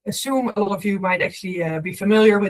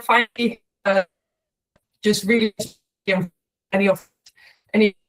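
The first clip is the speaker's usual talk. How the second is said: scrambled voice. Real, not acted. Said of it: "connection is cutting out a bit".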